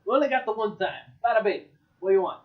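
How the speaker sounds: put-on Jersey accent. Barkeep to a customer, hammed-up Jersey accent. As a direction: lay the Jersey accent on thick. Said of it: "turning into a Jersey accent very quickly".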